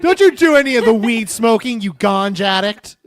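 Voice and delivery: loud, high mock telling-off; mock scolding baby. Unborn baby kicking and scolding mom over weed, mock-angry shout. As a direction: playfully shout it as an outraged scold.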